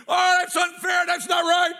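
loud cry of "that's not right" at the wrestling; teenage yelling at TV. Retold or imitated. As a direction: shout at the screen like a wronged young fan.

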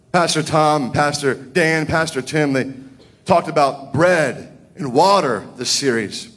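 normal talking voice

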